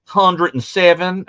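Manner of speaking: high, slow Texas local voice; local drawl. Retold or imitated. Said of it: "do that voice again".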